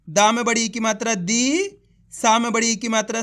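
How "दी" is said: दी with a stretched, rising ee; drawn-out vowel. Shown as a demonstration. Teacher shows learners how to read the long ee, drawn-out दी. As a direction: hold the long ee in दी. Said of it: "खींच के पढ़ा जाता है".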